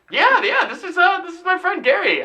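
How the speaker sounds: high-pitched, overeager introduction; eager playing along. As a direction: sell the fib with gusto. Acted playing along with the lie.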